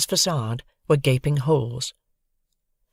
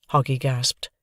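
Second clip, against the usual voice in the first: ordinary delivery, nothing marked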